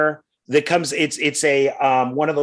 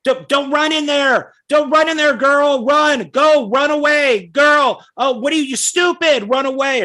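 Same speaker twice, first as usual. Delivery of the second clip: high, loud mock yelling at the girl on screen; 10 semitones higher, 3 dB louder than usual. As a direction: shout warnings at a horror heroine. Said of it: "And she'd be like".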